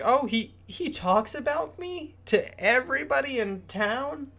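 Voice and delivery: high, wide-eyed disbelieving question; amazed Ophelia. Retold or imitated. Ophelia hears her father brags of her, high and amazed. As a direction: squeak it out in happy disbelief. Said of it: "Ophelia's like".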